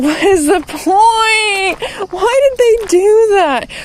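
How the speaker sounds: high, drawn-out whiny disbelief; slow, high-pitched frustrated complaint; exasperated whine. Real, not acted. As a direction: wail it up high like you can't believe it. Exasperated rant about broken quotes.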